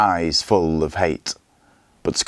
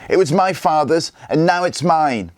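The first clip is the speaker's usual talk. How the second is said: yelling; 9 semitones higher than usual. A narrator's reading. The boy defying his father's killer, a high yell. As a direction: shout it at him, unable to hold back. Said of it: "yelled Gunnar".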